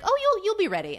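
high-pitched, dismissive mock reassurance; breezy reassurance. Voices boss brushing off doubts.

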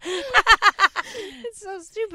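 loud high laughter, words slow; collapsing into laughter; laughing hard. Real, not acted. Breaks up after reading word.

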